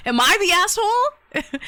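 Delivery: high giggling question; squealing laugh at herself. Real, not acted.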